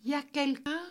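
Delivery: put-on high little-girl voice; high, childlike question; small voice. A narrator's reading. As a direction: call out timidly in a small, high voice. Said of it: "demanda la petite voix".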